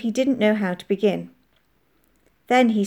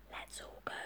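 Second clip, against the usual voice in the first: barely audible whisper; mouthed silently; 25 dB quieter than usual. A narrator's reading. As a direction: whisper it so only a friend catches it. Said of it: "mouthed to me silently".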